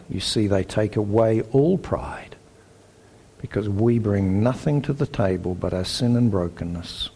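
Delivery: ordinary talk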